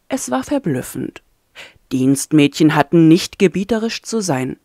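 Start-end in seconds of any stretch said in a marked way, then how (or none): none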